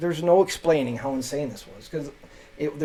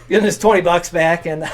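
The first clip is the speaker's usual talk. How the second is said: words broken by laughter; can't stop laughing; 4 semitones higher, 7 dB louder than usual. Real, not acted. Laughing while repeating.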